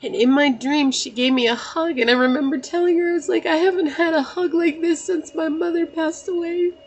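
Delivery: high-pitched, grief-filled telling; tearful emotion. Real, not acted. Daughter recounting dream hug from mother, tearful.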